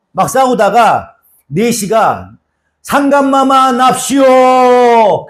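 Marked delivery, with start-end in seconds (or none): 2.8-5.3 court eunuch announcement. Retold or imitated. Eunuch in a historical drama announcing the king's entrance, drawn out. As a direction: stretch it like a palace eunuch announcing the king. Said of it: "딱 그래".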